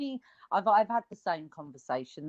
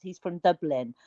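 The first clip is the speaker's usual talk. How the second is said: ordinary talk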